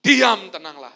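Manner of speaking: loud firm command; commanding rebuke. Shown as a demonstration. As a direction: model the words to the congregation. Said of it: "Yesus mengajari kita".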